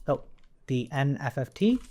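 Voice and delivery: ordinary talk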